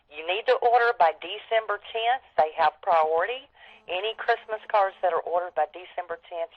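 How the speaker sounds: normal talking voice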